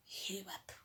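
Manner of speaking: soft whispered hey what; whispered disbelief; whispered surprise. Retold or imitated. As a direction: hush it like a shocked aside. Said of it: "whispered aside".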